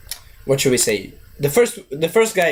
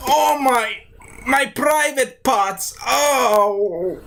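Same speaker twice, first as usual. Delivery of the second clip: high pained squeak; 7 semitones higher, much slower than usual. Retold or imitated. Kicked in the nuts, Trevor squeals in high pain. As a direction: voice jumps high, slow pained whimper. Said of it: "he's like".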